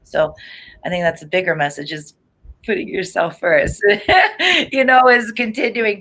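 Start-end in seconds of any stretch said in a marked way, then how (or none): 2.6-6.0 laughing. Real, not acted. Reader advising a client to put herself first, laughing. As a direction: laugh breaking into the words. Chuckles while giving her advice.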